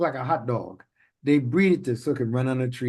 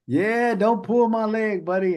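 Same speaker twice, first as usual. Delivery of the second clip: mock folksy idiom; 9 semitones higher than usual. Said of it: "throw out an idiomatic expression like".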